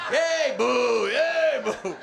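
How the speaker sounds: high, loud mock crowd shout; schizophrenic cheering and booing. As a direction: flip between cheering and jeering. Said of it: "the whole audience would cheer".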